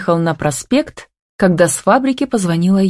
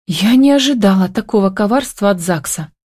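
ordinary delivery

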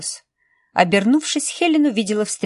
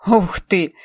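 surprised. Acted. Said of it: "удивился молодой человек".

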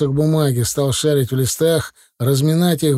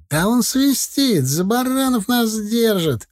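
heated outburst; 9 semitones higher than usual. A narrator's reading. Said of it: "распалился Шкет".

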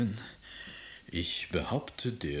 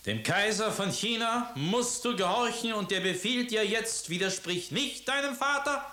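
much higher, loud decree; imperial command; 11 semitones higher, 3 dB louder than usual. Acted. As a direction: lay down the law to the defiant girl. Emperor commanding his daughter.

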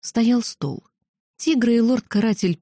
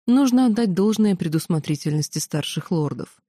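usual speaking voice

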